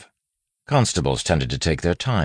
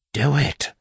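high-pitched, spat-out urging; spat order; 13 semitones higher than usual. A narrator's reading. Wayne urging the bandit on, spat order. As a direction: spit the order out in street accent. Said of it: "he spat".

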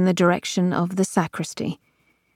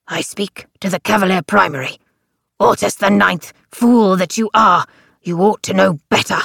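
put-on stern old man voice; 5 dB louder than usual. A narrator's reading. Crux scolding Ortus.

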